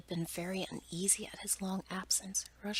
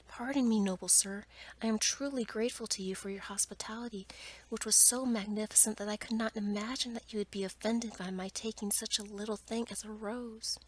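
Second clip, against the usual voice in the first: ordinary delivery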